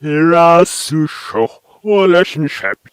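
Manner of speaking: loud, slow, strangely swelling syllables; "hirasusho, relationship" stretched out, as if from reversed tape; reversed backwards speech. Shown as a demonstration. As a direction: sound like backwards speech flipped forwards. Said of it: "Reverse that".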